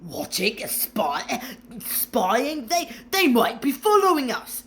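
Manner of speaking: rising panic, stumbling over the words; a man growing paranoid; wild muttering. A narrator's reading. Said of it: "muttered Uncle Vernon wildly".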